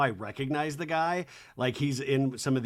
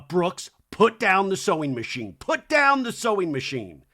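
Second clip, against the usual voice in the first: higher, emphatic mock order; mock scolding mom; 3 semitones higher, 4 dB louder than usual. Mother telling son off at his sewing, mock scolding. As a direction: imitate a parent losing patience.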